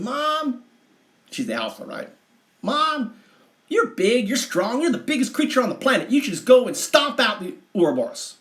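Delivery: put-on high, pushy child voice demanding; a girl goading her mother to fight; angry child. Sulky daughter urging her mother to stomp them, high and pushy.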